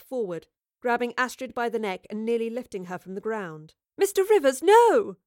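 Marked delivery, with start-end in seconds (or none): 4.0-5.2 high, loud alarmed cry; horrified shout. A narrator's reading. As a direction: cry out to stop him, pitched way up. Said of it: "Jane cried".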